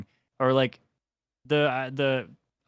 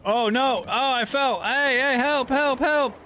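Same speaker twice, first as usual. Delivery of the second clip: panicked cries for help; 4 dB louder, slower than usual. Real, not acted. Character falls off the boat.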